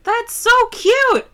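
high, drawn-out mock yell; stretched, high-pitched mock gushing; imitated catcall. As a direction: imitate a gushing shout from a passing car. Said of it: "this man in his car yelled at us".

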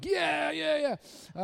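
loud mocking high yeah yeah; sarcastic yeah yeah. Kids mocking their dad, loud sarcastic yeah. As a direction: scoff at dad like a teen.

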